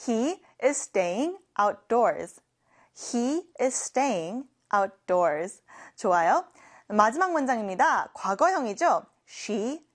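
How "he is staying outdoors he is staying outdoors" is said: slow, clear teacher read; slow model sentence. Shown as a demonstration. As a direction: say it slowly for learners to copy. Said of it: "같이 해볼까요". (천천히 따라 하기 시범)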